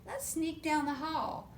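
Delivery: ordinary delivery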